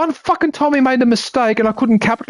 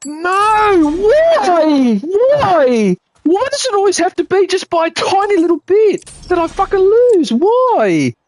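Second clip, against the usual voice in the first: frustrated outburst; 10 semitones higher, slower than usual. Real, not acted. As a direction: howl at the unfair loss, real frustration. Loses minigame by a hair.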